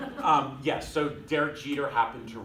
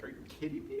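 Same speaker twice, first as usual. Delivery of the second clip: mock disbelief; 6 dB quieter, faster than usual.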